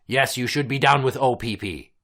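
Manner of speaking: ordinary talk, nothing marked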